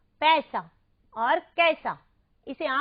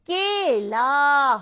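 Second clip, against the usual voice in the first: drawn-out word; 7 dB louder, much slower than usual. Shown as a demonstration. Teacher presents word to children.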